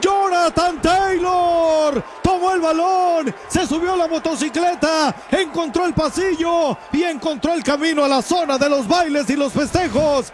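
building from loud call to high shout; excited play-by-play. Real, not acted. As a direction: climb with the run to the end zone. Narra en vivo la jugada. (narración que crece hasta el grito)

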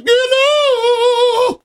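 very high, held-out falsetto hello. Shown as a demonstration. Co-host demonstrating his bathroom answer, high falsetto. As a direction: flip right up into falsetto and sustain it. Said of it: "I usually go in a high falsetto voice".

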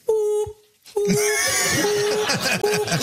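high, repeated mechanical boop; imitating a truck beep. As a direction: beep like a slow truck ladder. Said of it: "the truck was like".

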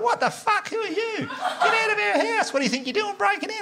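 very high, mock-panicked female voice; outraged lady. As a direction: shriek like a woman facing intruders, without extra volume. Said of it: "then the lady was like".

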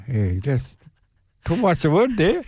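normal talking voice

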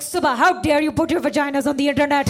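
loud, outraged mom scolding; a touch of a shocked mother; mother scolding daughter over her video, outraged; freaking out. Retold or imitated. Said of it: "my mom called me freaking the fuck out".

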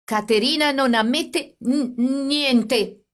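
high, slow, agitated stutter; stammered protest, trembling with fear and anger; stammering. A narrator's reading. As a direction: stumble on the n of "niente". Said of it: "egli disse balbettando".

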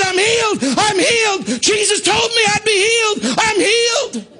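very high, crying shouts; retold ecstatic screaming. Preacher acting the healed woman jumping round the car, screaming. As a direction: cry it out in repeated high bursts. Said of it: "screaming and shouting and crying".

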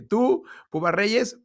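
high, slow mock of an angry boss; hammed-up president singling out a journalist; mock Florentino. Imitating Florentino pointing at him.

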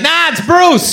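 high-pitched mock correction; breezy correction. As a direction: pitch it up as a quick brush-off. Imagined correcting of the caller.

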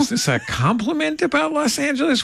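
high-pitched, drawn-out comic disbelief; incredulous retort. Retold or imitated. Host recounting his reaction to the remark, incredulous.

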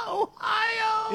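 very high cry amid laughter; hysterical shriek. Real, not acted. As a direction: squeal the word while cracking up. Cracking up, answering where he called.

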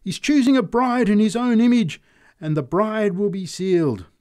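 high, loud proclamation in triumph; triumphant answer. A narrator's reading. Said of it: "she answered triumphantly".

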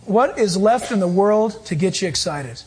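normal talking voice

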